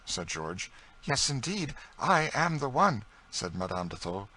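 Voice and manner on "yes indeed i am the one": high, slowing, emotional affirmation; emphatic lady's voice, raised pitch; earnest confirmation. A narrator's reading. Long-lost sister confirms who she is.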